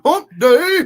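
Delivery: high, loud hype call; excited shout. Acted. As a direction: yell it like a hype man. Hyped show catchphrase.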